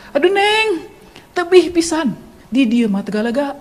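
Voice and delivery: high, lilting Sundanese exclamation settling down; Sundanese driver. Retold or imitated. Driver tells girl it is far, Sundanese lilt. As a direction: answer as a Sundanese minivan driver. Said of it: "Dan dia berkata, [sopir angkot]".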